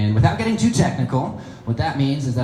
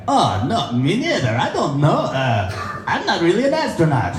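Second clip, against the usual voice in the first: higher-pitched Russian astronaut playing dumb; Russian accent; 7 semitones higher than usual. Russian astronaut hiding what he knows from American, Russian accent. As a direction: deny it all in a Russian accent. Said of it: "The Russian guy is like".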